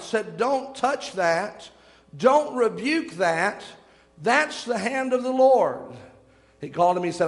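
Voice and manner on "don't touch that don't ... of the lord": very slow, measured warning; spaced-out words with pauses between phrases; solemn command. Retold or imitated. Retold solemn command from the light.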